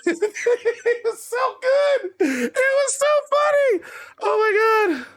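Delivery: high, real laughter through words; can't stop laughing at the punchline. Real, not acted. Host finishing the FTR story, laughing hard.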